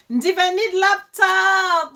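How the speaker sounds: excited. Real, not acted. Reading a viewer's comment.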